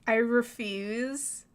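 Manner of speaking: slow, stretched-out words; drawn-out refusal. Real, not acted. Player balking at stealing the copper urn, drawn-out refusal.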